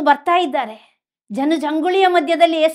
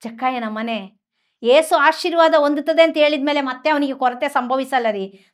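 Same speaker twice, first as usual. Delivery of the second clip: ordinary delivery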